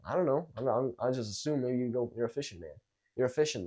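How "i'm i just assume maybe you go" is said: ordinary talk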